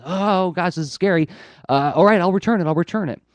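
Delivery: high-pitched, hammed-up panic; mock fright. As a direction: give in to the ghosts in comic alarm. Villain scared by haunting ghosts.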